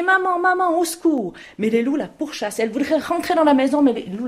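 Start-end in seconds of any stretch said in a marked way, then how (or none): 0.0-1.3 put-on high, louder child's cry for help. Girl surrounded by wolves, crying out for her mother. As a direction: call out for mother in fear. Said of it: "Elle se met à crier".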